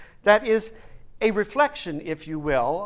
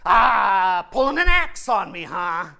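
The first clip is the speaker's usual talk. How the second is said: blustering lion; 3 semitones higher, 7 dB louder than usual. Acted. Lion rounds on the Tin Man, loudly taunting. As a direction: pounce on it with comic outrage. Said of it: "he turns to that Tin Man and he goes".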